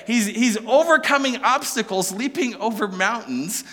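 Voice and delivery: usual speaking voice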